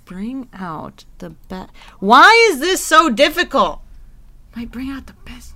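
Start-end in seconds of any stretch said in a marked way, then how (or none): 2.0-3.8 exasperated. Real, not acted. Frustrated guessing game. 4.6-5.5 whispering. Real, not acted. Muttering the hint to herself.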